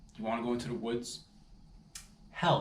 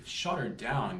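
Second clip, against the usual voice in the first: normal talking voice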